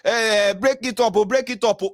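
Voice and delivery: loud, high pleading cry to break it up; shouting plea. Retold or imitated. Crowd pleading with police, a loud desperate cry to break it up. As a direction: yell it out, begging them to stop. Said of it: "That's why we dey shout, say".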